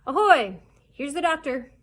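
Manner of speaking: loud, high hailing call; hearty calling. A narrator's reading. Doctor announcing himself to the camp, calling heartily. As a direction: hail them from a distance. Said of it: "hearty voice calling".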